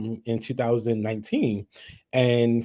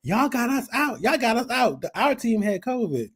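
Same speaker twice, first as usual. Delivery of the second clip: mocking rival fan; 10 semitones higher than usual. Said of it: "And then she gonna say about".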